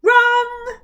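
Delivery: very loud, high cry of "Wrong!"; loud interruption. Acted. Rebecca loudly interrupts the mouth's recital. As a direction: cut him off with a shout. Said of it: "Rebecca interrupted loudly".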